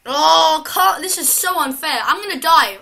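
loud, despairing outcry; panicked outcry. Real, not acted. Player panicking about dying in the game, loud despair. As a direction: cry out in panic.

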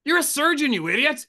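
high, loud exasperated jab; mock scolding. Mocking the surgeon, a high loud scolding jab. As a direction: scold him like a fool.

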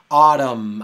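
Autumn said very loud, ending firmly on the M; Autumn with final M. Shown as a demonstration. Teacher reminding the student to end Autumn on M. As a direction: give Autumn a clear closing M. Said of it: "pronunciando la M al final".

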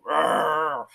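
short growling grunt in reply; imitated grunt. Recluse answering an offer of help, imitated grunt. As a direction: mimic a surly man's grunt. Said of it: "the guy just grunted at me".

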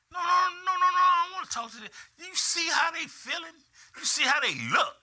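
high, loud, fast panicked refusal; retold shout. Pastor replays refusing to go talk to them, high frantic shout. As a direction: yell no, no, no as if backing away. Retells refusing to meet people.

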